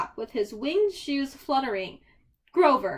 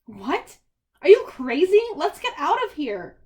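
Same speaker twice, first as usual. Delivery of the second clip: panicked outburst; 4 semitones higher, 7 dB louder than usual. Acted. Percy panicking at the plan.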